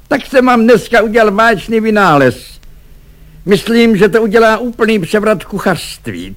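high-pitched, eager proclaiming voice; excited cook Jurajda. A narrator's reading. Cook proclaims his invention to the clerk, high and excited.

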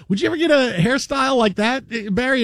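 light mock of the host asking; imitating Bill Pito. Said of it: "Bill Pito says".

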